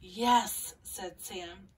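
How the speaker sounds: gentle picture-book read-aloud with small character voices; calm storybook reading for young listeners; reading to children. Acted.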